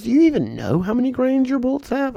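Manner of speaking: high, quick voiced veteran asking; mock incredulous old shooter. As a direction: needle the kid. Old shooter quizzes the narrator.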